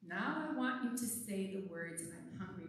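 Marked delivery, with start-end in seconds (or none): 2.0-2.7 barely voiced, hushed and small; very soft. Shown as a demonstration. Pastor showing children a near-silent I'm hungry. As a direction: say it so soft you can hardly hear. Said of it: "say them so soft".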